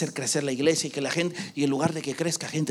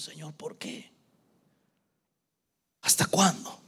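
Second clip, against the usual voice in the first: retold tearful prayer. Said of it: "en nuestro cuarto lloramos".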